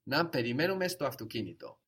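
Να περιμένουμε στο αυτοκίνητο stated firmly, falling at the end; affirmative tone. Shown as a demonstration. Teacher models "let's wait in the car", affirmative tone. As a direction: say Να περιμένουμε στο αυτοκίνητο as a decided suggestion, tune dropping on αυτοκίνητο. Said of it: "affirmative tone of voice".

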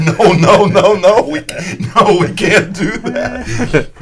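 high, loud, repeated mock-frantic refusal; head off a disaster, laughing by the end; panicked protest. Radio man stops the coach going over, panicked mock protest.

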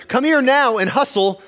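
mock dad calling across a field; dad calling kid. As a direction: yell for the kid to hustle over. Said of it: "a dad calling to his kid across the field".

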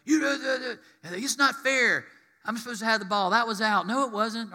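put-on high, whiny kid arguing; squabbling kids. Preacher mimics children arguing in a high whine. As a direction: play a kid squabbling over a ball. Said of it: "they're screaming and yelling at each other".

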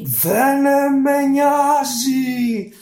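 high, loud, slow genie snarl; menacing drawn-out genie growl. Acted. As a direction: snarl it out as the monster. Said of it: "γρύλισε".

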